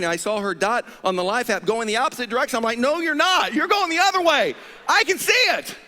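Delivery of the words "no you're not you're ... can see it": high, loud, frantic protest; exasperated shout. Retold or imitated. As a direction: yell it, caught her out. Retold catching wife's excuse.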